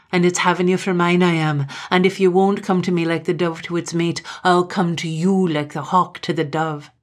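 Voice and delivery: loud, insistent Highland man, pressing harder; menacing suitor Gloom. Acted. Gloom forcing his love on Katrine, loud and menacing.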